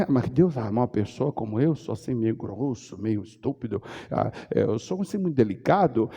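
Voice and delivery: voz baixa e mais grave, se lamentando; queixa imitada. Retold or imitated. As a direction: imite alguém se diminuindo, baixinho. Fiel se desculpando diante de Deus. (queixume imitado em voz baixa)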